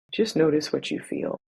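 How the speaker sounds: measured, soothing body-scan guidance; guided meditation. Acted. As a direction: ease them into noticing their breath, unhurried.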